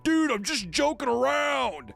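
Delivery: high, slow, whiny bully voice; school bully. Retold or imitated. Mimicking the bully after the locker shove, whiny and high. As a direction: drag it out like a bully faking innocence. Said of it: "She's like".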